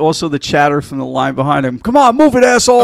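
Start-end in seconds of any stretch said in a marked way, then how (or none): 1.8-2.8 yelled impatient insult, higher; angry heckle. Retold or imitated. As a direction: shout it at the slowpoke. Angry crowd heckle quoted.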